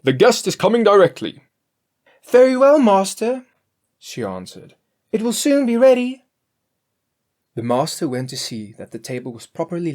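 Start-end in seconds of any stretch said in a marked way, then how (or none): 0.0-1.4 master's voice. A narrator's reading. 2.2-6.2 much higher, louder cook voice; Grethel's voice. A narrator's reading. Cook reassuring her master, much higher and louder.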